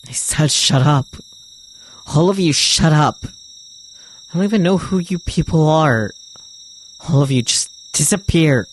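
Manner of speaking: loud, high, raging yell; furious screaming. Acted. Takuru screaming at the voices in his head to vanish, furious and loud. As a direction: scream them all out of your head.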